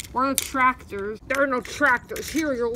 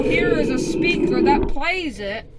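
usual speaking voice

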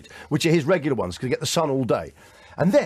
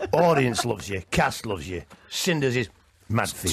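much lower, slow coaxing echo; imitating Paul Shane; 7 semitones lower than usual. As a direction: echo the old pro low and slow. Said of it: "I was thinking".

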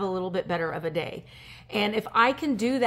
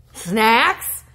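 surprised; 9 dB louder than usual. Real, not acted. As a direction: shout "Snacks?" with a sharp upward lilt. Surprised at snacks in letter.

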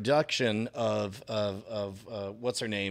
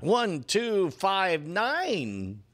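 goofy wrong count; 8 semitones higher, much slower than usual. Acted. Silly counting gag.

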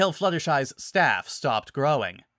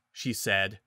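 plain reading voice

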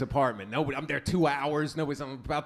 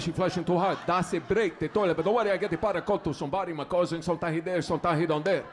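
put-on heavy accent with broken English; building super's foreign accent. Super explains a broken toilet to tenant, thick foreign accent. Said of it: "He's explaining all this technical stuff".

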